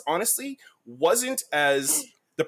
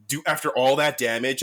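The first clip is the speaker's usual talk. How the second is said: ordinary delivery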